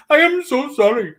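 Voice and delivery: high, loud mock apology. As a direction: squeal an exaggerated sorry. Mock apology after being told off.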